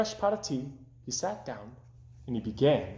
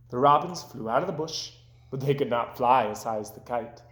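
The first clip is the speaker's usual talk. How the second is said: plain reading voice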